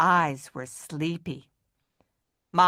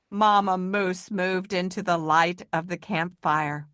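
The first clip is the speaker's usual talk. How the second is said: usual speaking voice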